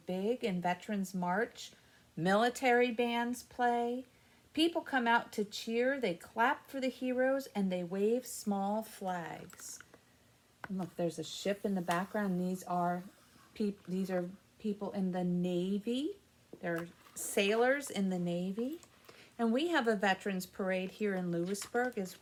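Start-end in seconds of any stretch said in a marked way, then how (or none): none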